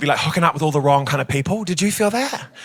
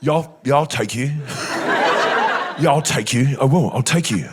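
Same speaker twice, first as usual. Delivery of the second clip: lower, a hammed-up sultry taunt; mock seductive growl; 6 semitones lower than usual. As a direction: purr it at him, lower each time. Mock seductive boast to guy.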